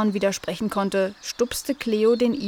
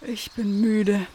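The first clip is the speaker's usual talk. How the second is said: slow, sleepy words out of a yawn; slower than usual. A narrator's reading. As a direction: stretch the words drowsily through a yawn. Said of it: "Dann gähnte er". (langsam und müde gähnend)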